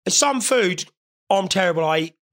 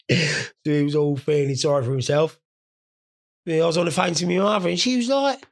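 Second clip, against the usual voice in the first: normal talking voice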